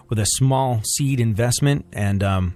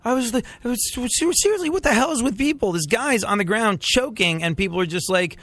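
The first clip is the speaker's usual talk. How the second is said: outraged; 10 semitones higher than usual. Real, not acted. Outraged by choking news.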